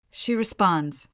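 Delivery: usual speaking voice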